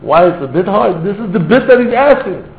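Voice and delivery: high, loud, slow impassioned plea; fervent preaching. Acted. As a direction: drive it home with fervor. Closing preaching burst.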